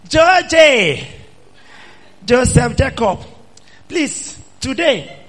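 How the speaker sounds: high, loud pleading temptress; loud high-pitched pleading woman; seductive wife. Acted. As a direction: coax him like a temptress. Preacher voices Potiphar's wife seducing.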